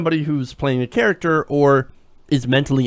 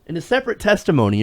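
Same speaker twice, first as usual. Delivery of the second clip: normal talking voice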